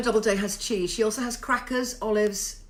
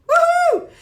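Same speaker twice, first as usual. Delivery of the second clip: high, loud woohoo; gleeful high whoop; excited whoop; 10 dB louder than usual. Real, not acted. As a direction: cheer out loud. Cheering a viewer's arrival.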